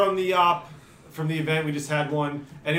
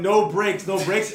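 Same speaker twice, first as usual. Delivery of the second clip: ordinary delivery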